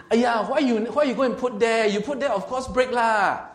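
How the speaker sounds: high, loud Singlish blame-shifting whine; blaming complaint. Retold or imitated. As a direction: scold the owner for leaving it there, loud and high. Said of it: "heard people when they broke something and say".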